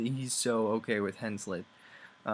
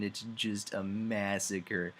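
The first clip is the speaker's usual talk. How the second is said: ordinary talk, nothing marked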